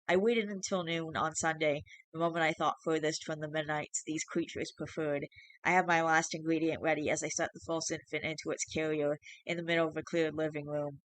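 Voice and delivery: ordinary delivery, nothing marked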